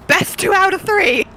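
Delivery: loud, high challenge; challenging shout. Pretend. Player challenging the orc in Orcish, loud high shout. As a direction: call out the rematch brashly. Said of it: "in Orcish".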